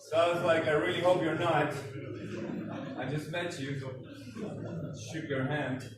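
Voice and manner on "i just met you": ordinary talk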